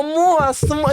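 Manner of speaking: voz fininha chamando o moço; chamado agudo. Retold or imitated. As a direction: chame o moço, bem agudo. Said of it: "Aí eu". (voz aguda chamando o moço)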